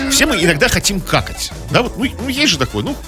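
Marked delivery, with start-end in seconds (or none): none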